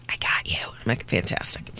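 conspiratorial whisper. Retold or imitated. Guard and visitor whisper a quiet deal. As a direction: breathe it like a secret.

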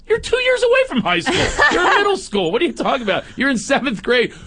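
high, mocking echo of the son; mock teen pitch. Dad teasing his son, mock high whine. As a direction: throw his whine right back at him.

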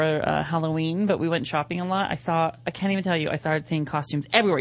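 ordinary talk

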